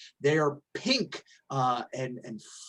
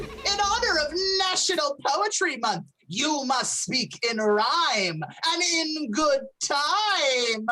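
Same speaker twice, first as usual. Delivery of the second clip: high pixie, slow and giggling; laughing pixie voice; 10 semitones higher, slower than usual. Acted. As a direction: chuckle through the curse. Pixie casts rhyme curse.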